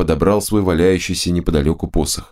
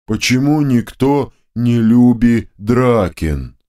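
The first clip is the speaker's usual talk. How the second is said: hurt booming drone; 4 semitones higher, slower than usual. A narrator's reading. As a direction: drone it out like a hurt dim giant. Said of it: "обиженно прогудела вторая голова".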